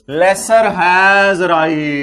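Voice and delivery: very slow, each word stretched, stress on Lesser; drawn-out emphasis. Shown as a demonstration. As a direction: spell the key phrase out for students. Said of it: "reading key phrase slowly". (धीमे-धीमे खींचकर पढ़ना)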